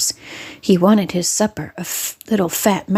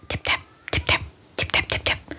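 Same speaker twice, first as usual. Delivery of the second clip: whispered tapping sound; 7 dB quieter, faster than usual. Acted. Mice tapping under teacups.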